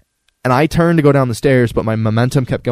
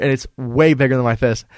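usual speaking voice

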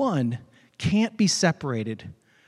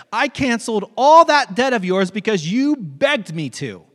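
high, loud scolding; acted anger; 6 semitones higher, 5 dB louder than usual. Master scolding the servant he forgave, angry. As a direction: rebuke with raised, forceful voice. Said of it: "In anger, his master".

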